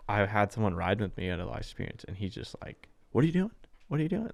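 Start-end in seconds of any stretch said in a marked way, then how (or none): 3.1-4.3 high-pitched mock yell, no real volume; retold yelling. Imitating ride-along passenger yelling at the driver, mock yell. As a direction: imitate an alarmed passenger's repeated challenge. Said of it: "they're just yelling at you".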